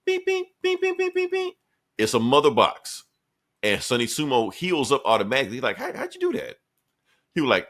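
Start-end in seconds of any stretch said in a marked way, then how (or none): 0.0-1.5 high imitated beeping; squeaky electronic beeps; mock beeping. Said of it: "he does this thing". 5.8-6.5 high, soft bewildered voice; high startled character voice; surprised question. Retold or imitated. Character asks in disbelief.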